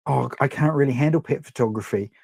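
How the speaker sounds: ordinary talk, nothing marked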